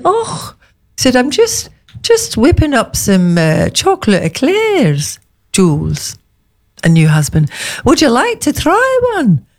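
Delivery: a lightly voiced Scottish chef, high and bright; chef's lilting Scottish offer; Scottish accent. Imitating the Scottish chef offering eclairs, light Scottish accent. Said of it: "You sound like Janet from Doctor Finlay's casebook".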